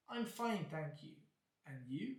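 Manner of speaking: ordinary delivery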